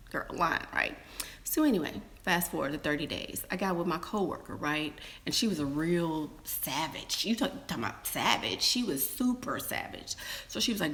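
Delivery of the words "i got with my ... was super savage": ordinary talk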